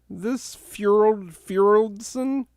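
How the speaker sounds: name said high in a put-on voice; a squeaky put-on voice mocking the name. Repeating guest's name in mockery.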